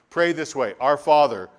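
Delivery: loud, ringing call to pray; emphatic quote. Shown as a demonstration. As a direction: proclaim it boldly to the room. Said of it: "except for those who didn't have a good dad".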